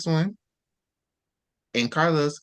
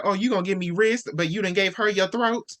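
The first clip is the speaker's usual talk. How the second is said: higher-pitched indignant questioning; upset, affronted; 5 semitones higher than usual. Acted. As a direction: act out hurt disbelief, voice raised in pitch. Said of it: "That's why she was so upset".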